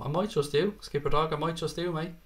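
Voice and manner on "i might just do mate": ordinary talk, nothing marked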